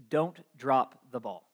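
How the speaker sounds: low, slow and grave; serious warning. Retold or imitated. Dad gravely warns his son before the play, low and slow. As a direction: lay down the law quietly, father to son. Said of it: "gets a serious look on his face".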